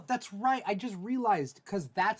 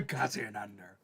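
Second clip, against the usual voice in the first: pirate voice; 8 semitones lower, 4 dB quieter than usual. Retold or imitated. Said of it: "it's a pirate".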